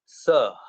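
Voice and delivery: सह as one short syllable ending in a puff of air; visarga breath in सह. Shown as a demonstration. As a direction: say sa, then just release breath for the ह in सह. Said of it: "उसके बाद बस थोड़ी सी हवा छोड़ दी".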